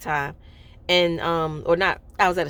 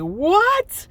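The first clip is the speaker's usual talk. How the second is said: high rising cry of disbelief; shocked what; 12 semitones higher, 4 dB louder than usual. Retold or imitated. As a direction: jump up in amazement. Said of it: "And I was like".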